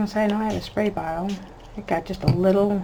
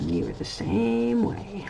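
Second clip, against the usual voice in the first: slow, softer, deliberate told-you-so; slow pointed verdict; 4 semitones higher, 4 dB quieter, slower than usual. Pretend. Mom slowly driving the point home to her son. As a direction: lay it down slowly, savoring it. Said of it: "and I'll be like".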